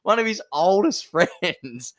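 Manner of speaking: high-pitched protest through laughter; laughing disbelief. Real, not acted. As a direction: crack up while naming the betrayed mate.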